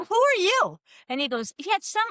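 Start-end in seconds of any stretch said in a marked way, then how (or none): 0.1-0.8 surprised challenge. Retold or imitated. Confronting a stranger at her door.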